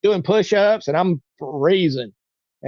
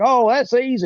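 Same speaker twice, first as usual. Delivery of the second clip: higher-pitched, airy mimicry of a preacher; breezy reply; 7 semitones higher than usual. Preacher to a man asking for a ride, breezy. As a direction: toss it off like it's no trouble.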